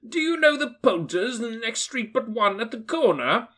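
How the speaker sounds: put-on Scrooge calling the boy. A narrator's reading. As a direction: hail him kindly. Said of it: "Scrooge inquired".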